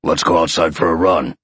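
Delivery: low, eager lion voice; deep-pitched lion raring to go; excited lion. A narrator's reading. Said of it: "found his lion feeling excited".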